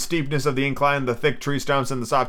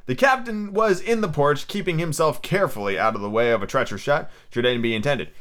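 usual speaking voice

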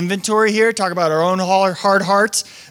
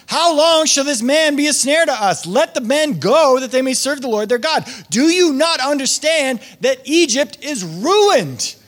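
pleading servants; 6 semitones higher than usual. Retold or imitated. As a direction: read it as the servants begging their king. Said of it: "said to him".

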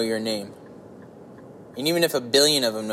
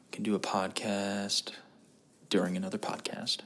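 whispered aside; 3 semitones lower, 7 dB quieter than usual. Real, not acted. Whispered punchline.

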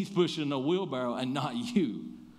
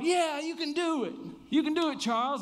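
much higher, eager crowd shout; cheering crowd; 13 semitones higher than usual. Retold or imitated. Crowd cheering Charles across the falls, much higher and eager. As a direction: cheer him on from the crowd, way up high.

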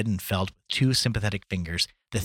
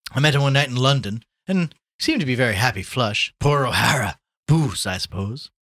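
lightly voiced hearty gossip, louder; breezy Gallagher, gossiping; 5 semitones higher, 7 dB louder than usual. Gallagher talks of poor O'Hara in London, breezy. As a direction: share news of an old pal.

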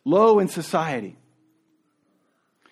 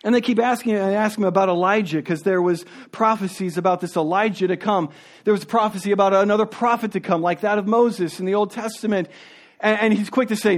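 ordinary talk

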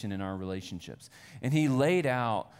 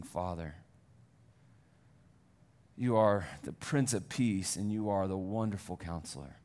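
prayer; 4 semitones lower than usual. Acted. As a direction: pray gently over the people. Pastor prays over the congregation.

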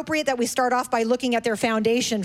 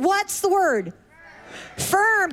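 singsong puppet show host; 7 semitones higher, slower than usual. Acted. Prompting kids.

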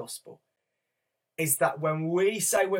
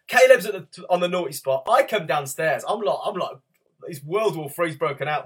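normal talking voice

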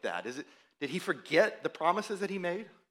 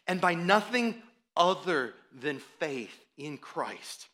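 normal talking voice

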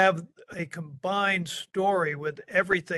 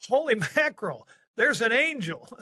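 high, fast, laughing holy mackerel; startled exclamation; 5 semitones higher than usual. Shown as a demonstration. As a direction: blurt it out in shock. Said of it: "but what happened is".

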